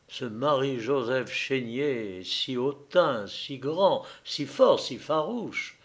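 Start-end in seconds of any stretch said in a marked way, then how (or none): none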